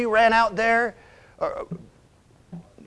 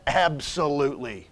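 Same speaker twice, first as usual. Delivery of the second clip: ordinary talk, nothing marked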